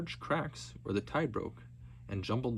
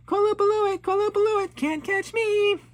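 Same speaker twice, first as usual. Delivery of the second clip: yelling as loud as possible; 11 dB louder than usual. Acted. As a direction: dare them at full voice. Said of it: "yelled as loud as she could".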